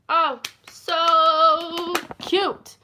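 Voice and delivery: loud, high, drawn-out squeal; squealing over adorable babies; gushing adoration. Real, not acted. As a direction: stretch it way out, cooing loudly. Gushing over newborn siblings.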